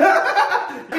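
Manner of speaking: high, loud burst of put-on giggles; hammed-up high tickled laugh; mock ticklish laughter. Shown as a demonstration. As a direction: laugh as if tickled before being touched. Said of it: "já começa a rir".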